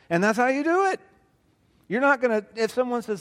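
mock Southern US twang. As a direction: keep up the put-on southern voice, pitched high. Still mocking southern small talk.

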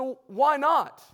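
loud, high, drawn-out retold question; insistent challenge. Preacher challenges friend who refused Christ, loud insistent challenge. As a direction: throw the question back at full volume, stretching it.

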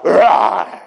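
sudden snarl like a stepped-on dog. Retold or imitated. Person turning on someone like a dog whose tail was stepped on, snarling. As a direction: snap out a mock animal roar. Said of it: "as if I was a dog".

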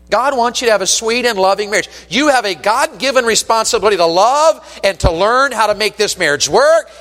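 earnest, insistent sermon exhortation; impassioned preaching. Real, not acted. Preaches at full force.

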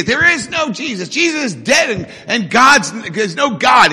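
a hint of an angry heckler; mock atheist yelling. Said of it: "he yells out".

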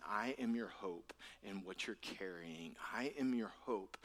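hushed, intimate voice of comfort; tender reassurance. Retold or imitated. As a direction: reassure the grieving, almost in a whisper. Jesus consoling the grieving, voiced softly.

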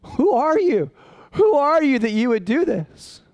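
high, wondering cry to God; awed question to God. Retold or imitated. Pastor retelling talking to God in Starbucks, high awed cry. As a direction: ask it overwhelmed with wonder. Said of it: "I'm sitting there going".

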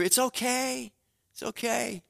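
reassuring. Retold or imitated. Father reassuring his grown child leaving home, gently. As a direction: soothe them like a parent letting go.

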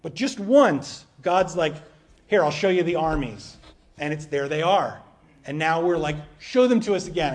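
preaching at full force. Acted. Pastor preaching loudly about God showing armies. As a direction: lean into it like a preacher hitting the peak.

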